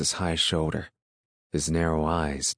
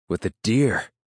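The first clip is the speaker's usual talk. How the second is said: raised, loud echo question; surprised check on what was said; incredulous question; 4 semitones higher, 6 dB louder than usual. A narrator's reading. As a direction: question DEER in disbelief, pitched up. Listener questions the surprising detail.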